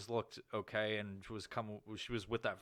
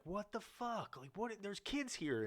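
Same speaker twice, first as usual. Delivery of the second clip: high, soft appalled onlooker; appalled passerby; 10 semitones higher, 5 dB quieter, slower than usual. Retold or imitated. Parents passing the booth, high and appalled. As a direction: mimic shocked parents walking by.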